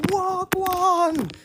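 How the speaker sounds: high, drawn-out cry of disbelief; exaggerated high wail; incredulous exclamation. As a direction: pitch it way up, stretching it in mock bafflement. Acts out her own baffled reaction.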